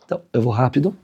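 usual speaking voice

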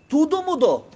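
high, loud exclamation; exclaimed. Real, not acted. The preacher revealing the story's outcome, loud and high. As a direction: burst out with the payoff.

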